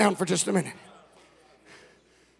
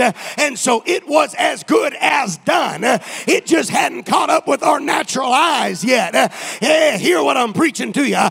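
louder, rising preacher; building preaching; 5 semitones higher, 5 dB louder than usual. Acted. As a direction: build it up toward a shout. Preaching at full voice.

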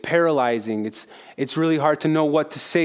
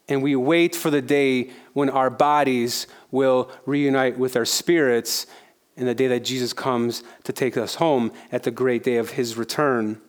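usual speaking voice

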